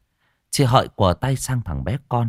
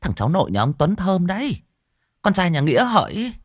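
gossip voice; 10 semitones higher than usual. Acted. Village gossip spreading news.

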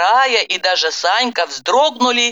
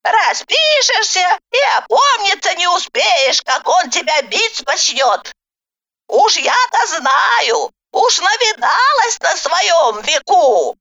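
put-on knowing old granny; 11 semitones higher than usual. A narrator's reading. Granny character. (многоопытная бабка нараспев)

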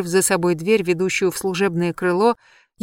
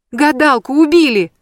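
high, loud panicked outcry; shouted alarm about the murder; cry out; 8 semitones higher, 9 dB louder than usual. A narrator's reading. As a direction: blurt it out at full voice. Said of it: "выкрикнула я".

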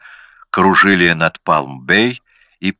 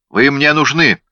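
higher, brusque summons; mild bark, pitched up; barked order; 6 semitones higher, 3 dB louder than usual. A narrator's reading. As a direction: bark it at a subordinate. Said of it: "рявкнул Медоуз".

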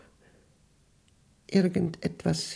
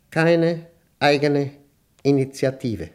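stern command; slower than usual. Acted. Dictating command to machine.

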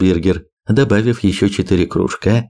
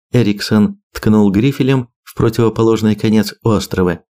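ordinary delivery, nothing marked

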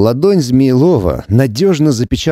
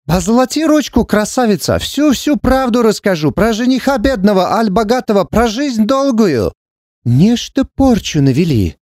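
high, singsong Romani fortune teller; 8 semitones higher than usual. Acted. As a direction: wheedle like a street fortune-teller. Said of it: "с каким гадалки пристают к прохожим".

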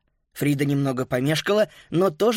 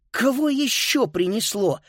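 high, mildly irritated question; cross; 7 semitones higher than usual. A narrator's reading. Girl spots yet another shadow, mildly cross. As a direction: ask with annoyance, voice pitched up. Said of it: "рассердилась Фрида".

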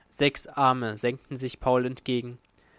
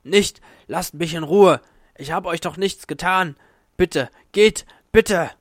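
loud, high pleading yells all the way through; desperate shouting; 4 semitones higher, 7 dB louder than usual. Acted. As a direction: scream at the monsters to leave you alone. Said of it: "rief er". (laut flehend schreien)

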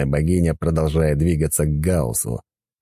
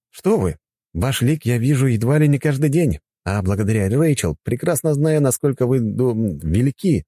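put-on lightly higher, courteous young man; polite young man voice; 7 semitones higher than usual. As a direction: speak as a respectful young god. Gauss speaks to goddess. (вежливый молодой мужчина)

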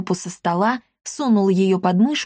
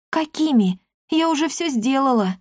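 put-on protesting girl; 6 semitones higher than usual. Girl answers back to old woman.

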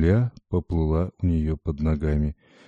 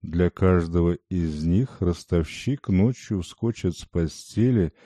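usual speaking voice

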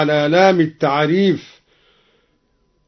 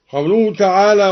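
ordinary delivery

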